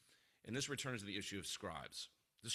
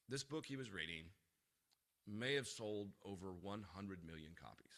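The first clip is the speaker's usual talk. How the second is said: normal talking voice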